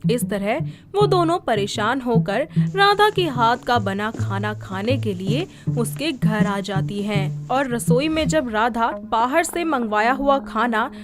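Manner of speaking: plain reading voice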